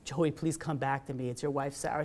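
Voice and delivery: low, soft retold pleading; tearful apology. Wife apologizing to husband in coma, softly acted sorrow. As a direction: act out a subdued, sorrowful apology. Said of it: "She's crying".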